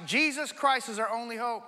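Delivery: ordinary talk, nothing marked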